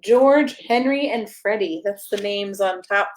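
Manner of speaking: ordinary delivery, nothing marked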